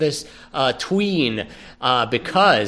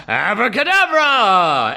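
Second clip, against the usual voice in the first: ABRACADABRA stretched slowly, rising high then falling; big slow magician's "Abracadabra"; drawn-out incantation; 5 semitones higher than usual. Acted. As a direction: intone "Abracadabra" long and grandly. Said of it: "he says real fast".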